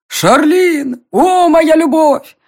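high, slow, drawn-out declaration of love; passionate lover's cry. A narrator's reading. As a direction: cry out like an overblown serenading suitor. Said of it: "выкрикнул он". (пылкий, протяжный возглас влюблённого)

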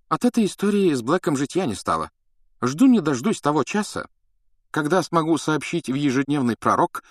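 lightly voiced self-satisfied minister; pompous official. Fudge quoted at length. (самодовольный чиновник)

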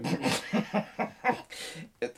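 hearty laugh. Acted. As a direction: laugh it off despite the pain. Verletzter lacht über Schlag. (herzhaftes Lachen)

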